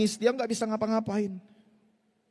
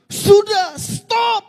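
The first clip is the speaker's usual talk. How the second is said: much higher and louder, a sharp shout; shouting stop; 13 semitones higher, 11 dB louder, much slower than usual. Retold or imitated. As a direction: cut him off with a yell. Redo stops the stepfather's rant. (teriakan keras menghentikan ayah tiri)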